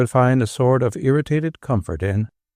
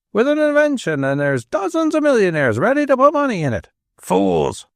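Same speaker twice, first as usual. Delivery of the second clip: angry Manchester rant; 10 semitones higher than usual. A narrator's reading. Father ranting about Americans, furious Manchester accent. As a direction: rail at America in broad Manchester. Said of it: "broadest Manchester accent".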